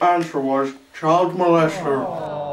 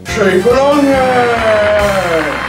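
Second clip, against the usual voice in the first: loud, slow drawn-out exclaim; excited host; 5 semitones higher, 7 dB louder, much slower than usual. Acted. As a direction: stretch it out triumphantly. Host repeats winning answer.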